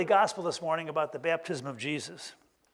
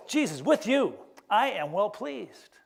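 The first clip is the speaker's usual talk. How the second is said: slow, louder blessing with weight; voice of God; 4 semitones higher, 4 dB louder, slower than usual. Acted. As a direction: bestow it slowly, a little raised. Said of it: "he hears the voice".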